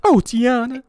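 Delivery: loud, very high Georgia belle squeal; Princess Tiana's friend, Southern accent. Acted. Host imitating a movie character from Georgia, loud high Southern voice. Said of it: "and she talks like that".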